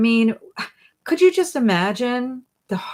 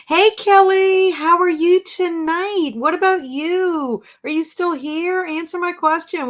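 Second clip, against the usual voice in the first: calling out; 8 semitones higher, 4 dB louder than usual. Real, not acted. Host calling on a viewer to answer her question, eagerly hailing. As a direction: hail someone brightly, starting loud and high.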